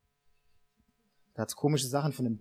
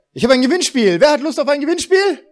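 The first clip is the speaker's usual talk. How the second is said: hyped-up prize announcer, loud and high; game show host; 10 semitones higher, 6 dB louder than usual. Pretend. Pastor hypes fake prize giveaway.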